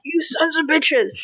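mock angry rant. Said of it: "I'm like".

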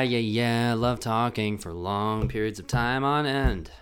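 ordinary talk, nothing marked

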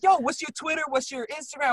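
high, keen imitation of another guy; eager request. Retold eager request for contacts.

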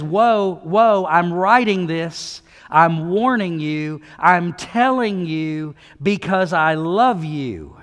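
loud, slow, urgent plea in God's voice; God's pleading warning. Acted. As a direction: warn them like a loving father. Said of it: "he says".

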